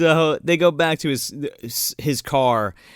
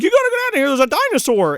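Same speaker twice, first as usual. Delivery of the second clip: high-pitched frantic warning; hammed-up panicked yelp, pitched way up; mock panic; 13 semitones higher, 4 dB louder, much faster than usual. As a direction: imitate someone sounding the alarm in terror. Acting character warning of dinosaur.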